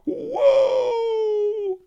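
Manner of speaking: very high, very loud crying wail; wailing rabbit. A narrator's reading. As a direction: howl in terror at the execution. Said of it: "wailed the Key Rabbit".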